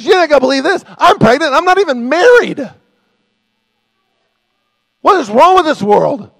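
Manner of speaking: high, loud, hammed-up outrage; frantic, shouted griping; mock indignant complaint. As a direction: wail the news in exaggerated disbelief. Acting Mary's mock complaint back.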